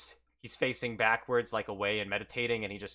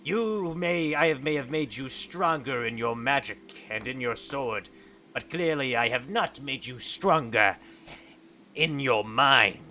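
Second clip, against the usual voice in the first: put-on stern old monk. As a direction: look down on your weak pupil. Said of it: "And Typhus says".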